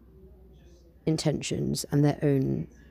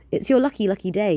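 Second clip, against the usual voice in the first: higher, louder cheerful genie; grinning genie; 5 semitones higher, 6 dB louder than usual. Acted. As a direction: announce it with a grin. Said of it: "he grins".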